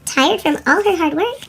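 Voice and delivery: plain reading voice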